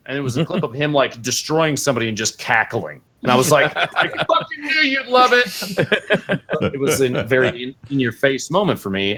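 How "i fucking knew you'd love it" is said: high, laughing retold exclamation; gleeful I-told-you-so. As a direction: crow it in a voice jumping way up.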